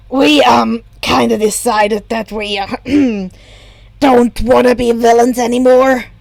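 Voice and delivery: a villain awkwardly admitting defeat; gruff Shigaraki. A narrator's reading. Shigaraki voiced rough.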